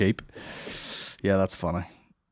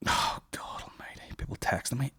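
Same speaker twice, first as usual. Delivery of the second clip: whispered; 3 semitones higher, 10 dB quieter than usual. Real, not acted. Muttering at phone texts.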